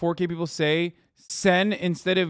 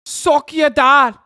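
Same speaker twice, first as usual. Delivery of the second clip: mock British taunt; 7 semitones higher, 7 dB louder, slower than usual. Mocking British slang.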